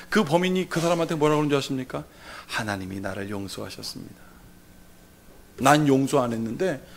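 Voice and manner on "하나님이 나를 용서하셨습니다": very low, hushed reply; serene calm. Acted. Murderer answering the victim's parent, hushed calm. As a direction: say it softly and low, untroubled.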